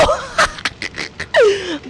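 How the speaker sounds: high-pitched pulsing giggle; high wordless laugh. Real, not acted. Gamer seeing fingers cut off, giggling high. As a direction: let out a high, broken giggle.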